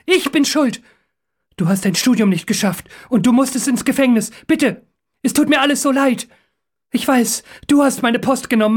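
high, urgent apology; desperate confession. A narrator's reading. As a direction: confess while begging for mercy. Captive confesses, begging for his life.

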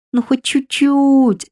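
whining. Acted. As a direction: beg like a spoiled child. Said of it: "законючил дух". (канючить высоко и медленно)

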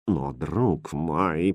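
slow, low, hushed reproach; indignant murmur. A narrator's reading. Queen scolding the king for loving tricks, hushed. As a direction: hold back indignation, very softly. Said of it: "прошептала королева, едва сдерживая".

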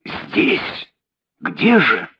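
stunned that the killer is here; breathy shocked echo; astonished. Acted.

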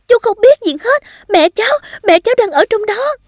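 high, urgent protest; desperate yelling. A narrator's reading. Child to firefighter holding them back, desperate yelling. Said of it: "cố vùng mình thoát khỏi vòng tay của người lính và la lên".

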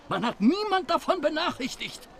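indignant outrage. Acted. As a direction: sound scandalised. Fremder empört über Reederei. (empörter, hoher Ausruf)

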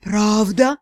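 low, doubting question; distrustful sea god. A narrator's reading. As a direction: ask incredulously in a deep voice. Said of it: "Недоверчивый вопрос". (недоверчивый низкий голос)

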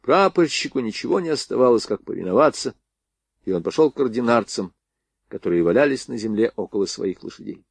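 ordinary delivery, nothing marked

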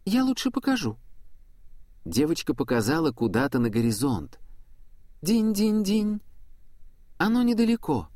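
put-on high airy little girl; young girl voice. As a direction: lure her on as an eager girl. Angel girl leads the way.

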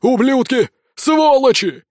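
loud, high furious cursing; shouting after them. A narrator's reading. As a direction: hurl curses at fleeing backs. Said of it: "кричал нам вслед староста". (яростный крик вдогонку)

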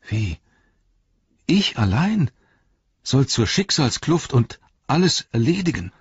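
high-pitched, disbelieving self-questioning; dismayed hobbit thinking aloud; Sam, daunted and incredulous. A narrator's reading. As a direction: protest at being left the task alone.

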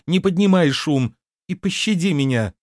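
high, urgent begging; pleading. A narrator's reading. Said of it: "взмолилась Лиса". (умоляюще, высоким голосом)

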